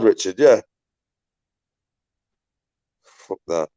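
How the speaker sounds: ordinary talk, nothing marked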